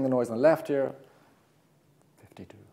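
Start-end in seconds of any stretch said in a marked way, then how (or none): 2.2-2.6 soft whispered number, close to the ear. Shown as a demonstration. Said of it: "numbers that I'm going to whisper in your right ear".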